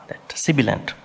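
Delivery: normal talking voice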